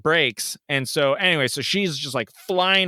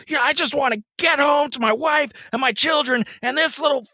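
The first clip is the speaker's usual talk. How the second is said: much higher furious shout; freaking out; 11 semitones higher than usual. Retold or imitated. Driver ranting to the calming lady, furious. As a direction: rant at the lady about the girl. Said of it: "me freaking out".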